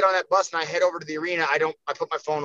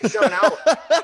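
louder, laughing through the reminder; laughing mock calm-down; 6 semitones higher, 5 dB louder than usual. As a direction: tease the hyped fighter while laughing. Repeats calming line, laughing.

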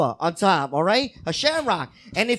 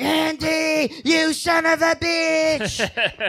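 Chucky shout; 5 semitones higher, much slower than usual. Retold or imitated. Chucky quote, shouted slow.

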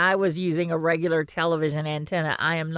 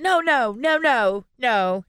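higher, rushing string of no's; mock denial; 6 semitones higher, slower than usual. Host recalls her reaction to the director credit, mock denial. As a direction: retell a mildly alarmed refusal.

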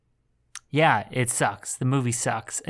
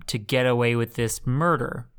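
usual speaking voice

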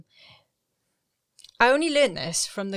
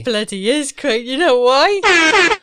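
louder, high, slowed hype; excited; 6 semitones higher, 13 dB louder, slower than usual. Real, not acted. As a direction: drag out the question with big hype. Hyping the special day.

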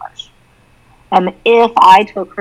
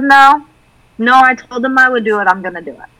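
firm, a bit louder refusal; determined girl; 6 semitones higher, 3 dB louder than usual. Retold or imitated. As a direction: insist on keeping her word. Daughter decides firmly.

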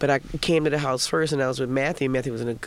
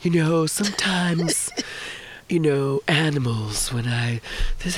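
hushed, airy musing with odd pauses; spacey lift driver. Retold or imitated. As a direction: imitate a gentle spaced-out driver, pausing between thoughts. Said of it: "he was definitely on some other shit".

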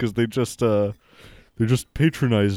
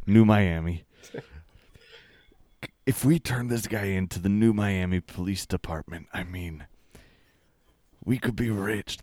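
hushed aside; 4 dB quieter than usual. Acted.

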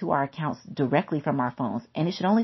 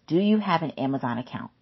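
usual speaking voice

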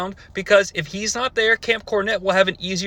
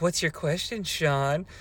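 much softer, sultry question; mock sexy; 3 semitones lower, 8 dB quieter than usual. [name] to caller Sean, mock sultry and soft. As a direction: purr it at the caller. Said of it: "selling this sexily".

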